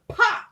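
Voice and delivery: loud, high explosive "pop!"; imitated thump. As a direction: punch it out like a brush smacking the floor. Imitating hairbrush hitting floor.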